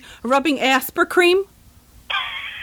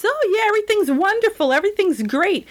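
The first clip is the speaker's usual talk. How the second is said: mocking; 8 semitones higher than usual. Mocking Hollywood stars.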